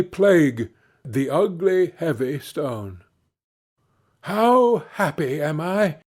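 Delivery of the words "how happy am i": a mild happy exclamation; joyful cry. Acted. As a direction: lightly cry out with joy. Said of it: "cried he".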